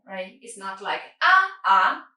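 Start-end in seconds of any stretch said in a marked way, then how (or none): 1.2-2.1 AH, AH short and loud; quick tones. Shown as a demonstration. Teacher contrasts long third tone, quick loud AH, AH. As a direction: punch out two quick, raised AHs. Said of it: "You could pronounce quick".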